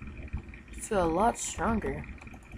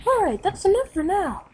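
character voice; 12 semitones higher, 6 dB louder than usual. Acted. Calling an end to training.

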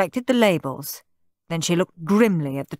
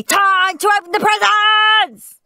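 high, loud eager shout; 7 dB louder than usual. A narrator's reading. Henry rushes everyone to the presents, shouting. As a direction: bellow it, itching to tear paper. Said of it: "shouted Henry".

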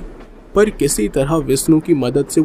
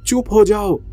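frightened yell; 6 semitones higher than usual. A narrator's reading. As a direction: cry out in dread, pitch raised, volume held back. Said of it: "वो चिल्लाई". (डर के मारे ऊँचे सुर में चिल्लाते हुए)

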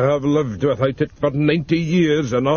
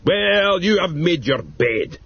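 put-on roaring old man; 8 semitones higher, 3 dB louder than usual. A narrator's reading. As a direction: roar it at her, triumphant. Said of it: "roared Mister Flaws".

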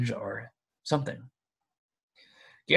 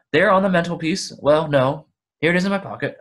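flustered; 6 semitones higher, 7 dB louder, faster than usual. Acted. Bilbo flustered, impatient.

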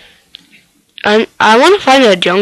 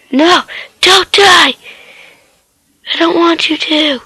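high desperate pleading shout; pleading cry; 8 semitones higher than usual. Real, not acted. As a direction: beg it not to die. Pleading with his pet.

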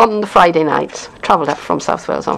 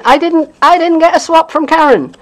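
higher, louder whiny moan of a left-out swapper; mock complaint; 10 semitones higher, 5 dB louder than usual. Imitating a swapper who missed out, whiny and louder. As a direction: play the hard-done-by crafter, a touch hammed.

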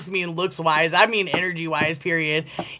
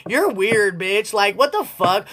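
ordinary talk

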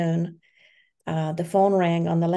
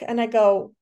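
ordinary talk

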